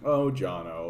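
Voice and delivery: a sigh of indulgent dismissal; head-tilt dismissal. Retold or imitated. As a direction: brush him off with a tilt of the head. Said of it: "a head tilting, like".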